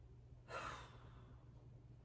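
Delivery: long drained exhale; weary sigh. Real, not acted. Between confessions to camera, a weary sigh. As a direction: let it all out in one breath.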